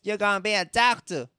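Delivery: a hammed-up demanding dad. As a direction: lay down the law like a pushy parent.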